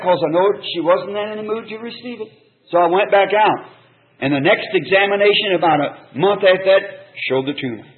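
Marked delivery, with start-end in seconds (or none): none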